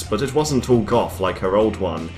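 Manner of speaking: ordinary delivery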